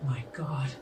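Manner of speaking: a sudden breathless whisper; stunned whisper. Acted. Lucid elf looks up at her, whispering in shock. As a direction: gasp it out in shock.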